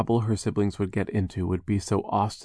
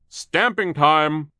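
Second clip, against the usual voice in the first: slow, high, loud bellow; gruff foreman voice; 8 semitones higher, 8 dB louder, much slower than usual. Acted. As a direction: call the crew to work.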